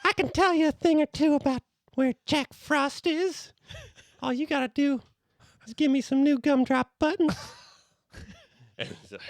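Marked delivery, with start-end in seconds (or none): 0.1-7.3 tiny, high squeaky cookie voice; gingerbread man. Acted. Gingerbread man bargaining with Santa for buttons, tiny high voice. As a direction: squeak like a little cookie hustler. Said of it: "is the gingerbread man".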